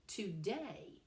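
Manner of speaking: "today" with the stress on DAY; stress on second syllable. Shown as a demonstration. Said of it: "the stress is placed on the second syllable".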